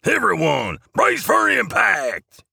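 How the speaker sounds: high-pitched warning shout, stretched out at the end; Jingleman shouting. A narrator's reading. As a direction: warn the whole crew in a very high cry. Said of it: "shouts Jingleman".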